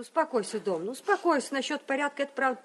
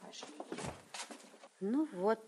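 normal talking voice

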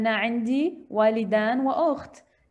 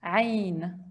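usual speaking voice

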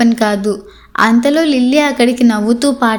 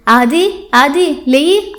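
slow, drawn-out wake-up call; gently acted call, stretching the words; calling to wake someone; 3 semitones higher, much slower than usual. A narrator's reading. Woman waking the man asleep beside her, calling out slowly. Wife waking sleeping Adi.

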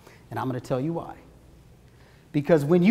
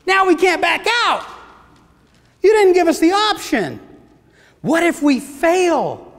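higher, louder flustered inner panic; a brain scrambling in mock alarm, higher and louder; panicked inner voice; 6 semitones higher, 3 dB louder than usual. The speaker's brain panicking over a promise, higher and louder mock alarm. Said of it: "My brain is now scrambling, going".

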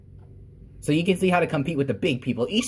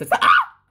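sudden yelp; 8 dB louder than usual. Real, not acted. Game mishap mid-argument.

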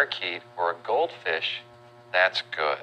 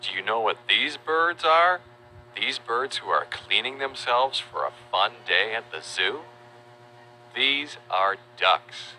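put-on reading to a toddler. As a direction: coo it to a toddler. Talks to toddler viewers.

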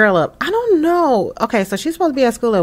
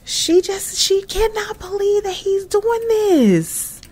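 shocked disbelief; 13 semitones higher, slower than usual. Real, not acted. Describing her disbelief.

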